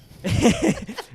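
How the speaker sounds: high, loud burst of laughter; sudden high-pitched cackling laugh. Real, not acted. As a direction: crack up mid-story. Cracks up at the stench.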